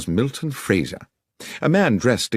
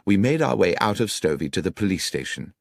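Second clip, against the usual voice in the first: ordinary delivery, nothing marked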